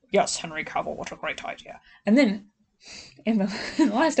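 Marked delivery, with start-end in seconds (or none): 0.1-1.8 much lower mock-aged voice, trailing to a whisper; hammed-up deep old-man take. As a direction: drop the voice down to sound older. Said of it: "he just lowers his voice".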